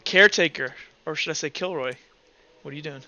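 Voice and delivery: ordinary talk, nothing marked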